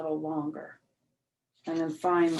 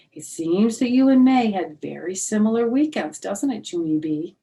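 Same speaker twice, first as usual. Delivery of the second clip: louder, higher pointed teacher; knowing teacher; 3 semitones higher, 10 dB louder than usual. Acted. Teacher confronting Junie B in the hallway, louder and pointed. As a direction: catch the child out, knowingly.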